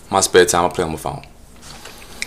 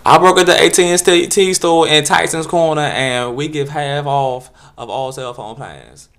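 higher, lilting; mock campy guy; 4 semitones higher, 8 dB louder, slower than usual. Solicitor introducing himself, lilting and high. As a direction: sing the name out lightly.

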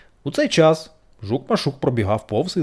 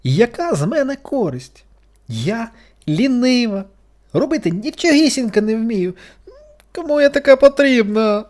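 near tears; 11 semitones higher, 6 dB louder than usual. A narrator's reading. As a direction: whimper it close to crying. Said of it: "майже плакала гусениця". (гусениця майже плаче)

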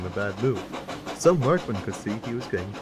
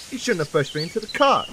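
high, huffy accusation; 9 semitones higher than usual. A narrator's reading. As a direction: blame your twin in a huff. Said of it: "huffed Bill".

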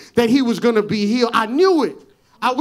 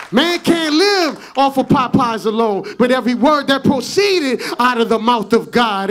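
loud, rising preacher's call; full-force preaching; 6 dB louder than usual. Acted. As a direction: drive it home to the congregation.

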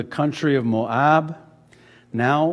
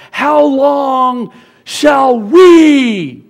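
furious drawn-out yell, much higher and louder; angry shout; 12 semitones higher, 15 dB louder, much slower than usual. Retold or imitated. Moses shouting angrily at the complaining Israelites, slow furious yell. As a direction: bellow it slowly in rage. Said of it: "in his anger, he says".

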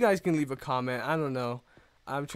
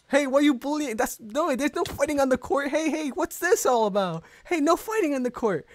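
much higher put-on voice, scolding the fighters; referee breaking up fight; 11 semitones higher, 3 dB louder than usual. Official stopping a fight between players on the court, high scolding voice.

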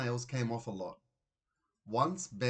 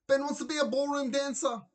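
much higher, louder gushing reveal; mother announcing the news to the father, much higher and thrilled; excited announcement; 6 dB louder than usual. A narrator's reading. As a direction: burst out with the big news. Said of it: "Mum chimed in".

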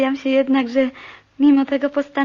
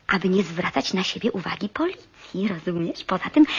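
ordinary delivery

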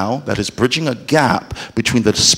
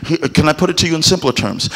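normal talking voice

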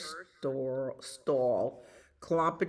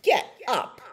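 high, loud punched command; whinny it like a horse; 8 semitones higher, 6 dB louder than usual. A narrator's reading. Horse ordering the sheep out, high loud whinny. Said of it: "whinnied horse".

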